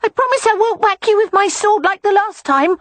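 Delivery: put-on a little boy tugging his aunt outside; high wheedling child voice; coaxing small boy. A narrator's reading.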